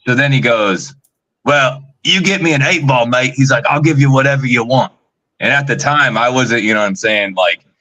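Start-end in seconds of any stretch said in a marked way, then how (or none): 1.4-3.3 imitated Australian, pitched up; Australian accent. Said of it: "he's got this Australian accent". 3.7-4.9 lightly imitated Australian; Australian accent. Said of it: "he's got this Australian accent".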